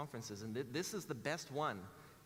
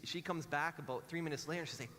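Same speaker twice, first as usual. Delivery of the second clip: ordinary talk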